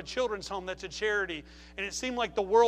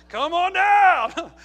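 big, drawn-out, much louder call, pitched high; mock-delighted come-on-down shout; joyful shout; 8 semitones higher, 14 dB louder, much slower than usual. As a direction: holler it slow like welcoming a windfall. Gleefully accepting the offered help.

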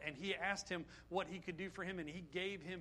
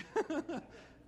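a real burst of laughter at his own joke; short laugh; 9 semitones higher than usual. Real, not acted.

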